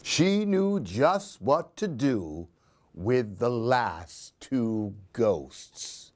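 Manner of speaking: put-on lively read-aloud for a child; expressive children's story reading; storybook narration. A narrator's reading. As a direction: tell it like a bedtime picture book. Reads picture book aloud.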